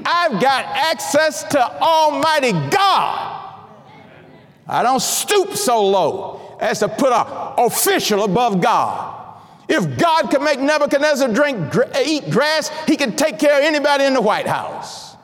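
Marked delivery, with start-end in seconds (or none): 0.0-3.4 preacher declaring his access to God; slow preached shout. Acted. Preacher proclaims over mocked worldly access. 9.7-15.2 raised preaching. Acted. Preacher builds to a climax.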